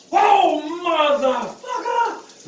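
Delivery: slow, high shouted curse; drawn-out cold curse. Real, not acted. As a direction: let the swear stretch out in pain. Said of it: "I'm trying not to cuss".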